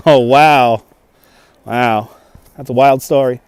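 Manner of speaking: ordinary talk, nothing marked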